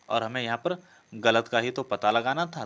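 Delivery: normal talking voice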